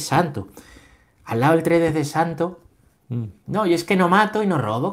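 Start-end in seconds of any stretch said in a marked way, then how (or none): 3.5-4.9 lightly mimicked higher-pitched objector; mock self-excuse. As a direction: plead innocence, raised pitch, half in mockery. (imitando una excusa con tono agudo)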